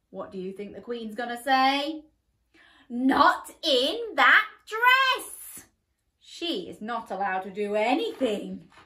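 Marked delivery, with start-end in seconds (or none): 2.9-5.7 loud, very slow flat refusal; stern queen. Acted. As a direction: shut her down firmly.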